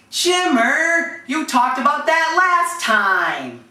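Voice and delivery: high, drawn-out mock complaint; Rhonda. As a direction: imitate her scolding, stretching the words slowly. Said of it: "Then Rhonda was right there".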